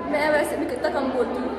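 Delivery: normal talking voice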